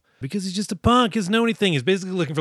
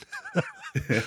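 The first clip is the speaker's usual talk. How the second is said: amused laugh; 8 dB quieter than usual. Real, not acted. Laughs at the retold scene.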